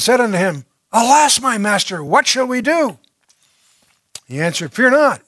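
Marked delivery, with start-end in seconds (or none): 0.9-3.0 higher, louder cry of alarm; the servant, seeing the army, cries to his master in fear. Acted. Frightened servant sees army. 4.7-5.2 high, loud and firm; firm reassurance. Acted. Prophet reassures servant.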